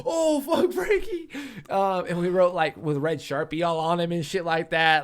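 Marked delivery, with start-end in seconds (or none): 0.1-1.3 high outcry through laughter; laughing oh fuck Frankie. Retold or imitated. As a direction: cry out while cracking up. Friends laugh at the fall.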